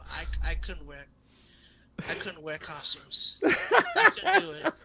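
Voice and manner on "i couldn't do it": high squeak through laughter, slow; laughing. Real, not acted. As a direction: break into laughter on it. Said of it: "laughing admission".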